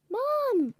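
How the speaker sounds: high joyful cry of Mom; excited call. Acted. Son sees his mother again and cries out happily.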